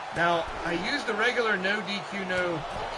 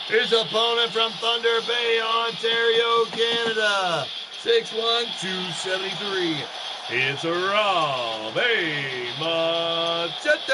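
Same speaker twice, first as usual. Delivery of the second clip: introducing the next wrestler like a ring announcer; ring announcer intro; 4 dB louder, slower than usual. Acted. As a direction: boom out the name to the arena.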